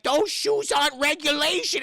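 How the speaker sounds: high, loud mock outrage; indignant shout. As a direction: bark the rule at him. Referee objects to shoes.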